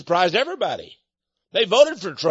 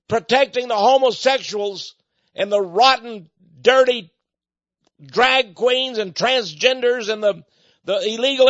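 higher and louder, hammering each insult; furious tirade; 5 semitones higher, 4 dB louder than usual. Real, not acted. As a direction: rage at the city, louder on dirty. Preacher raging about the city.

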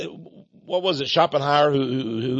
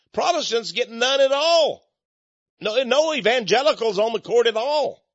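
higher, protesting outburst; a sudden raised protest; indignant shout; 7 semitones higher than usual. Real, not acted. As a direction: cry out at the injustice. Indignant climax of the sermon.

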